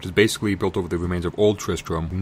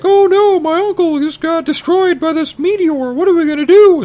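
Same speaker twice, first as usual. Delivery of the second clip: very high, loud falsetto wail; hammed-up high-pitched panic; whiny Leia; 6 dB louder than usual. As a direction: shriek it like a helpless damsel. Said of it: "Leia's like".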